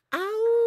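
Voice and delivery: high loud howl; wolf howl. Acted. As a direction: throw back the head and howl. Wolf howl ending the song.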